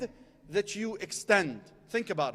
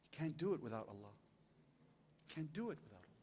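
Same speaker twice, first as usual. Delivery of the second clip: much softer and lower, almost a murmur; hushed reflection; 6 semitones lower, 13 dB quieter, faster than usual. Acted. Preacher dropping to a hush about needing God's help. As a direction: pull them in with a hushed voice.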